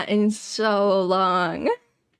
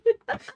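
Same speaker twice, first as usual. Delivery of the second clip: squeaky gleeful gremlin giggle; 11 semitones higher than usual. Real, not acted. Squeal of laughter.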